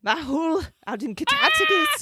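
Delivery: hammed-up Aussie voice, shouted; Australian accent. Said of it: "my Australian accent".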